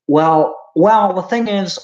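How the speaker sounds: high, loud reedy lecturer voice; Jordan Peterson impression. Streamer impersonating Jordan Peterson, high and loud. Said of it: "always talk like this".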